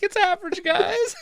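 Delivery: very high, laughing fake-tearful voice; mock crying. Friend imitating a man reassuring the guys, mock tearful squeal. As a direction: squeak it out as if smiling through tears. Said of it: "You're just, you're just crying. You're smiling".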